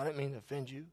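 quiet, kind and humble; soft gracious apology. Retold or imitated. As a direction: apologize gently to the angry driver. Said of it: "Very kind, gracious".

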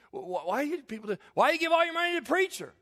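put-on high, loud, fast, sneering teen; mocking teenage self. Pastor mimics his scoffing teenage self, sneering high squeak. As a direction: stammer out the jeer, then shoot up high and loud. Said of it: "that's kinda the attitude I had".

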